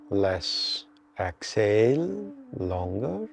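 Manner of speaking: slow, measured meditation guide with long pauses; guided meditation. Real, not acted. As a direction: draw out inhale and exhale cues to pace the breath. Leads a breathing meditation.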